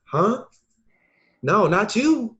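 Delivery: high, loud, incredulous huh; stunned disbelief. Retold or imitated. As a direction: reel back as if you can't believe it. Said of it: "I'm like".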